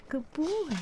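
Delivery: high cooing praise; pet voice. Real, not acted. Owner praising the dog, high cooing.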